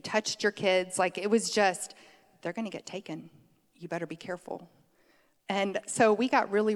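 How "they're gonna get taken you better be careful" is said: hushed warning. Retold or imitated. Quoting the warning again, hushed.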